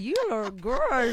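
put-on teasing take on an American accent; imitated American accent. Host mimicking the guest's compliment, mock American accent. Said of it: "Yona le accent".

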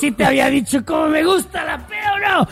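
voz aguda de borracho, sin subir el volumen; borracho fingido. Pretend. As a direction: haz de borracho confesándose, en broma. Sigue el juego del borracho. (tono alto y exagerado de peda)

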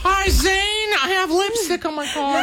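high-pitched, hammed-up holler; mock calling out. Car owner hailing the detailer about lipstick, mock calling out. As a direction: call out in a silly high voice.

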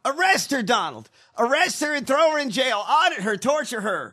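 high, loud mock demand; mock egging-on. Cohost eggs Trump on against Pirro, mock demanding.

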